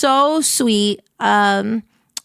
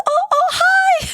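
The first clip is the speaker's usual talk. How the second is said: high surprised greeting; slower than usual. Retold or imitated. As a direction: squeak out a flustered hello. Imitating Shy Lily's surprised greeting.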